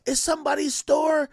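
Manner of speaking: loud, high, slow mock shout; exasperated girlfriend. Girlfriend scolding him about Tay, a loud mock shout. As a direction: shout it like she can't believe he doesn't know. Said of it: "She's like".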